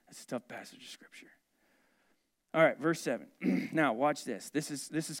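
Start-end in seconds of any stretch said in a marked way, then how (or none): 0.1-1.3 hushed aside. Real, not acted. Hushed aside after hard verse.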